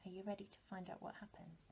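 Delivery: quick, eager whisper; excited whisper. Acted. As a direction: whisper the invitation quickly, full of anticipation. Teacher invites children to the story.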